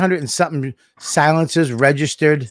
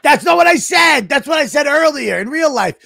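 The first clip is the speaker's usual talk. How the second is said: high, raised-voice objection; exasperated protest; 9 semitones higher than usual. Real, not acted. Acted redo collapses into mock quarrel.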